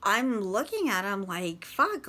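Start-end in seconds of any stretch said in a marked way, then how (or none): 1.6-2.1 alarmed. Retold or imitated. Scared reaction to husband gasping.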